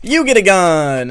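normal talking voice

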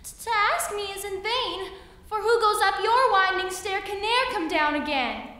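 high, loud little fly voice; buzzy housefly. Acted. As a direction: squeak it high and urgent. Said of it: "said the little fly".

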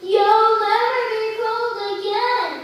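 high, loud, slow scared troll; terrified troll. Acted. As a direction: blurt it out in terror. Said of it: "said the terrified troll".

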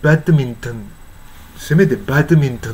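"バドミントン" as bad-min-tun, t released; fully articulated English. Shown as a demonstration. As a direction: sound all three syllables of "バドミントン". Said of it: "せめてバドミントンって言ってほしい".

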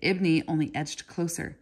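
ordinary delivery

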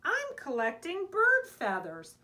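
high, loud call. A narrator's reading. Little Mouse explaining to Big Mouse, calling out. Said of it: "he called".

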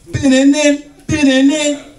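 high sung SportsCenter sting; imitated TV jingle. Stoners hear SportsCenter go off, mimicked jingle. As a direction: belt out the theme notes, high.

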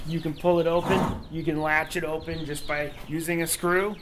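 ordinary delivery

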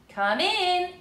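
loud, high shout from inside; yelled. A narrator's reading. Moonface shouting through his door, loud yell. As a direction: call out loudly through the door. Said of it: "yelled a voice".

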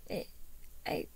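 put-on halting little voice of a lost child; whimpering. A narrator's reading. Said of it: "the boy whimpered".

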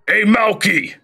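high shout across the yard; a dwarf shouting for the stablehand; calling out. Acted. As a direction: yell down to Malky.

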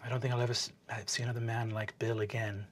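low, soft, wistful aside; quiet musing. Acted. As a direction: say it low, almost to yourself. Player's character muses softly.

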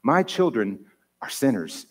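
usual speaking voice

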